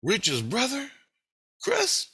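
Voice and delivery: high-pitched, questioning double-take; imitated Julie. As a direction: check the name with rising surprise. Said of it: "I think it was Julie said".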